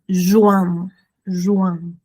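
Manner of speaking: "yuan" with a nasal vowel at the end; nasal sound demo. Shown as a demonstration. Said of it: "Tenemos el sonido am".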